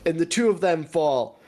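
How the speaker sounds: ordinary talk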